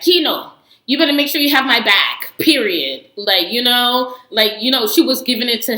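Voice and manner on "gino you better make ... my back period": mock bossy girlfriend. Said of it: "Jasmine was just like".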